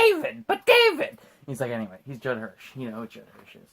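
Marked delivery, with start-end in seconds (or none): 0.0-1.1 high, loud put-on voice; hammed-up scolding father; mock exasperated dad. Father pleading with son David, exasperated put-on voice.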